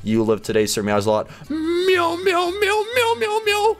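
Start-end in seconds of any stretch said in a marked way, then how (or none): 1.5-3.8 rapid-fire imitated meowing; high squeaky cat meows. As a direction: mew like a complaining kitten, very high. Voices the cat Sir Meowslot.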